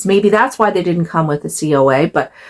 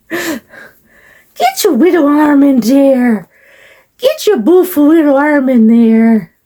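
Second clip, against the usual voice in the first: put-on high, slow sing-song baby talk; 5 semitones higher, 4 dB louder than usual. Doll owner dressing her baby doll, cooing baby talk. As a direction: coax a baby along in a high, lisping coo.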